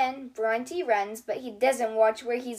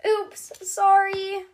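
high, slow sheepish apology; sorry, stretched out and meek; sheepish dino; 9 semitones higher, 5 dB louder, slower than usual. A narrator's reading. Bronte apologizing after knocking over Surfer Girl.

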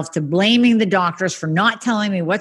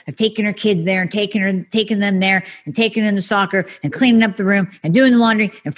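higher and fast, piling up chores; breathless rush; 5 semitones higher than usual. Acted. Overwhelmed mom rattling off chores fast and breathless. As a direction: rattle the list off without a breath. Listing a good mom's chores.

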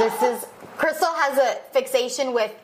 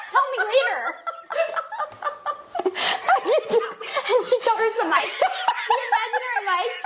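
high, laughing retelling; 12 semitones higher than usual. Retold or imitated. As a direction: giggle through the story.

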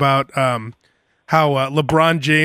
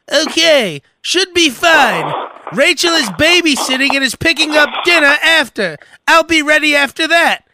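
mock friend voice; 9 semitones higher, 4 dB louder than usual. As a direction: read his text in a high goofy voice. Reading friend's text in voice.

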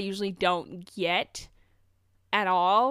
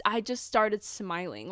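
ordinary talk, nothing marked

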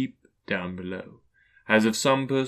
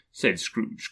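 ordinary delivery

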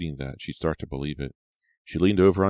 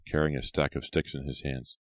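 plain reading voice

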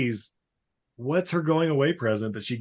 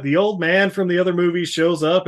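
ordinary talk, nothing marked

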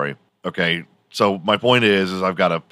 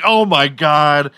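shocked; 7 semitones higher, 7 dB louder, slower than usual. Real, not acted. Shocked by the racist output.

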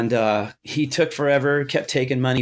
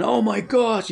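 mock yelling; 9 semitones higher than usual. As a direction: retell a thrilled yell in a raised pitch. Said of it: "just kinda started yelling".

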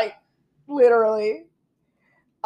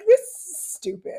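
exasperated Erica; 12 semitones higher, slower than usual. Retold or imitated. Erica voiced, mock exasperation.

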